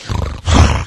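noisy mimed puffing and splashing; imitated swimmer splashing. Host showing how the swimmer goes by, wordless splashy puffs. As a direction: imitate a swimmer blowing through water. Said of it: "the guy goes by like".

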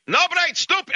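very high-pitched mock Russian yelling; screaming crazed Russian. Crazed Russian berates the man parked against his bumper, screaming. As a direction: rant in a frantic, high imitation of an angry Russian. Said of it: "he's screaming and yelling, the Russian".

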